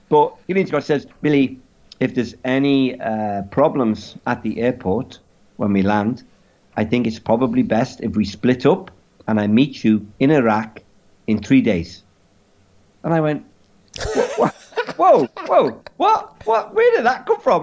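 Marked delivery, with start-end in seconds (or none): 1.2-12.0 measured mock-French accent; caricature French accent. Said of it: "in a caricature French accent". 14.2-17.6 very high, stammering mock squeal; alarmed disbelief. Retold alarmed reaction to friend.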